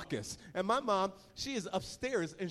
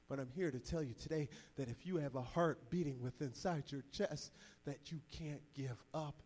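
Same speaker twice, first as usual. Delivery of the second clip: soft, low, tender exhortation; hushed sermon appeal with weighty pauses; earnest preaching; 4 semitones lower, 8 dB quieter than usual. Real, not acted. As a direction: speak hope gently to each listener. Turns from story to earnest appeal.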